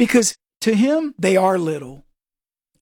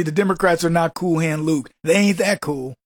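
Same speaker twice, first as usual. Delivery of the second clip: ordinary delivery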